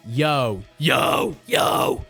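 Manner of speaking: deep open-throated growl on yo; low scream. Shown as a demonstration. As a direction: growl yo like a big giant. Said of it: "start doing it".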